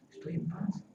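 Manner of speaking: hushed whisper of peace. Shown as a demonstration. Preacher shows the peace God gives, whispered. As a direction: breathe it out in a whisper.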